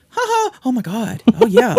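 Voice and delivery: high excited imitation of a loud fan; mocking loud moviegoer. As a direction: ham up an obnoxious gushing viewer. Mocking loud couple in theater.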